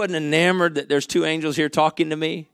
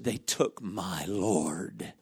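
softer, slow and drawn out; hushed and slow; 6 semitones lower, 9 dB quieter, much slower than usual. Acted. Mary answering the angels, hushed and slow. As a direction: let it fall quietly, grieving.

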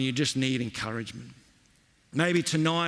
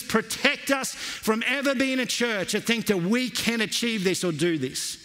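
usual speaking voice

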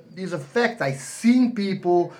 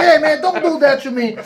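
higher, louder mock complaint; Ali's playful protest; 7 semitones higher, 6 dB louder than usual. Muhammad Ali protesting to the boxer, playful and loud. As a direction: protest with a grin. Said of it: "And Muhammad Ali".

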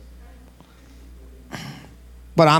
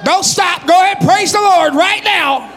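high, shouted preaching at full force; shouting faith healer. Acted. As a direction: command the sickness out, full voice. Commands healing at full force.

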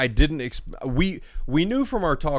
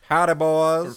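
a louder, higher smug villain greeting; villain's greeting; 5 semitones higher, 5 dB louder, slower than usual. Retold or imitated. A friend voicing the unmasked villain, smug greeting. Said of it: "He takes off a mask".

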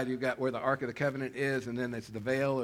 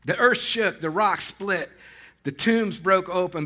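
dramatic proclamation; 4 semitones higher, 7 dB louder than usual. Acted. Dramatic retelling of crucifixion.

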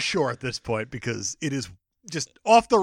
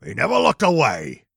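put-on gangster voice, louder; Big Boy; 2 semitones lower, 6 dB louder than usual. Crime boss catching on to Dick Tracy, gangster voice. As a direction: play the suspicious crime boss, loud and hammy. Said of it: "Big Boy caught on to Dick Tracy".

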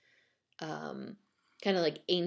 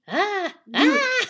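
high, loud acted screams; terrified cry; 12 semitones higher, 9 dB louder than usual. A narrator's reading. Terrified man to soba seller, screaming. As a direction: scream in escalating mock terror.

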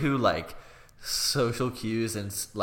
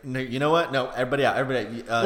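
raised voice, ushering everyone out; mock announcement; 3 semitones higher, 6 dB louder, much faster than usual. Host kicking everybody out, mock loud call. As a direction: call out like a host clearing the room. Acting out him clearing guests.

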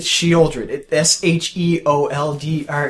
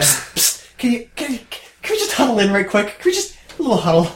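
high, hurried psst and huddle-up; mock discreet summons to a huddle; conspiratorial huddle; 7 semitones higher than usual. Co-host playing out taking Lee aside, mock conspiratorial. Acts calling a friend into a huddle.

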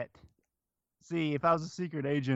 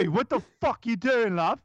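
high, thick mock British accent; exaggerated British guard voice; British security guard; 9 semitones higher, 4 dB louder than usual. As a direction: challenge an intruder in hammed-up British. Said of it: "just moved there from Britain".

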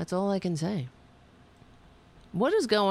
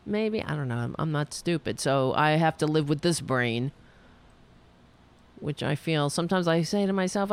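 normal talking voice

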